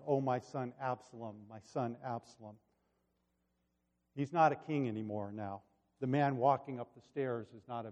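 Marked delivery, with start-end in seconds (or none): 0.1-2.6 soft, hushed lament; subdued echo of grief. Retold or imitated. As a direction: recall the cry quietly, well below full voice. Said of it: "terribly wonderful about that cry".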